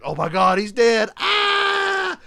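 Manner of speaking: hammed-up shock, building to a scream; shocked outburst.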